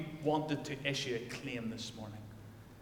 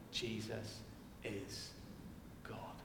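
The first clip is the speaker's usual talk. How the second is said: hushed declaration; 6 semitones lower, 15 dB quieter, much slower than usual. Acted. Solemn climax of sermon.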